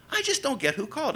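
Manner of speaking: high, puzzled voice with rising end; imitated baffled woman. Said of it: "finally she says to me again".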